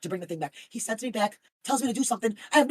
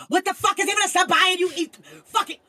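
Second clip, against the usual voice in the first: loud, higher disbelieving yelp; indignant outburst; 10 semitones higher, 8 dB louder than usual. Real, not acted. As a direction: blurt it out in outraged disbelief. Outburst reading donation.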